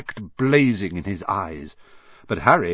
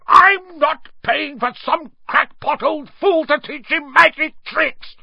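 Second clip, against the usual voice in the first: high, slow furious yell; 6 dB louder than usual. Acted. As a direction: yell it in a red-faced rage. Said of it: "yelled Uncle Vernon".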